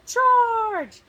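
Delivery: high, very loud charge call; battle cry. Acted. Knights rushing at the dragon with a loud battle cry. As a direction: yell the knights forward into battle.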